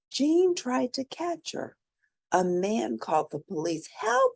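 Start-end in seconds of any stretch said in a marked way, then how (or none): 3.9-4.3 high cry for help; panicked yelp; yelled help. A narrator's reading. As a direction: call out for help, high and scared. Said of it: "he yelled".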